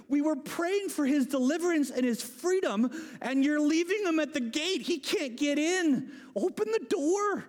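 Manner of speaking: high, hammed-up scolding; exasperated disbelief. Believers to Rhoda at their meeting, exasperated. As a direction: plead in mock outrage, pitch climbing to the end.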